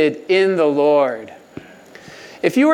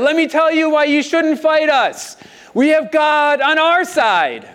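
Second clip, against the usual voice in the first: confident king; 8 semitones higher than usual. Retold or imitated. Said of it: "He said".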